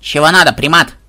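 rude bird snarling at the man; snapping parrot. A narrator's reading. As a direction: bark it like a grumpy parrot. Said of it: "огрызнулся попугай". (огрызающийся попугай, громче)

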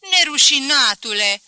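loud, outraged cry of insult; furious old spinster screams at the servant; shouting. Acted. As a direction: hurl the insult at him. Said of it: "îmi strigă Bondoaca".

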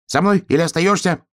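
high-pitched urgent hedgehog call; shouting. A narrator's reading. Hedgehog urges bear cub to follow, shouting. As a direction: call it high through the noise. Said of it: "крикнул ёжик сквозь шум леса".

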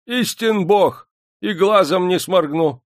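put-on very high, emphatic old-man oath; bold old man. A narrator's reading. Old man faces Demidov and swears, boldly. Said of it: "Старик смело поглядел".

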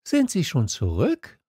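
put-on high, curious young questioner; Little Prince. A narrator's reading. Prince questions the switchman, in a high voice. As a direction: ask it high and light. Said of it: "sagte der kleine Prinz".

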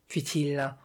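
plain reading voice

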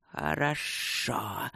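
low, soft, drawn-out hiss; slyly approving rustle. A narrator's reading. Serpents accept Luke's refusal, slyly approving soft hiss. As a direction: rustle slowly with sly approval. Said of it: "со странной одобрительной ехидцей прошелестели змеи".

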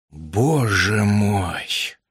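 slow, low, awed exhalation; exhaled in shock. A narrator's reading. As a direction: breathe it out, stunned. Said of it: "выдохнул он". (на выдохе, потрясённо)

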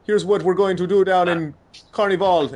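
put-on loud, high Trinidadian accent, hyped; mock Trinidadian accent, loud and high; Trinidadian accent impression. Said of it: "when I try to do an Island Times accent".

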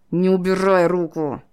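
strained, loud pained order; hissing with pain, urgent; through clenched teeth. A narrator's reading. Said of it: "выдавил он сквозь зубы".

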